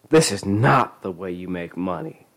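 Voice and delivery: ordinary delivery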